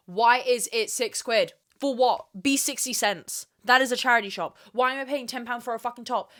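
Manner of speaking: usual speaking voice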